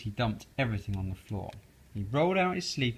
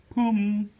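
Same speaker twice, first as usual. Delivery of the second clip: higher, louder pop; loud pum sound; 9 semitones higher, 8 dB louder than usual. A narrator's reading. Reader voicing the stuffed sack, loud pop. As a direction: punch the sound out.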